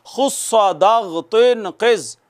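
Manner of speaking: measured chant of the letters; slow recited letter group. Shown as a demonstration. Teacher slowly reciting खुस्सा दाग़ तुन क़िज़ for students. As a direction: recite each syllable deliberately. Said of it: "आप भी दोहरा लें".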